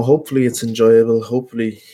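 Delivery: usual speaking voice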